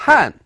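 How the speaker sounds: "Han" falling sharply from high; fourth tone. Shown as a demonstration. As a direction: drop "Han" hard from the top, fourth tone. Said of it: "fourth tone".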